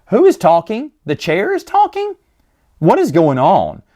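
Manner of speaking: can't believe a chair talks; incredulous questions. Real, not acted. Mock disbelief at talking chair.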